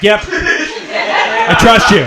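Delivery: high, slow, mock-trusting yep; drawn-out sarcastic trust. Comic to stranger, slow sarcastic high trust. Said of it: "I'm like".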